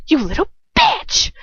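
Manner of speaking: put-on high, loud roar with the accent slipping; angry roar. A narrator's reading. Dolohov roars at the one who kicked him, angry roar. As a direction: roar it out, pitch shooting up. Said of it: "Dolohov roars. Oh, I lost the accent".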